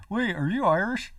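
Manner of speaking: high, mock-puzzled question; imitating a friend. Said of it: "And she's just like".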